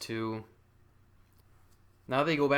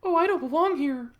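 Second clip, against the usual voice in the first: high, loud pleading character; Sarah; 7 dB louder than usual. Acted. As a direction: protest in a put-on high voice. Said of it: "I'd have Sarah be like".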